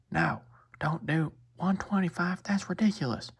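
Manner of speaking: high, slow mock country lady; impersonated country accent. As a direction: protest in a high-pitched rural accent. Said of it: "impersonation of her accent".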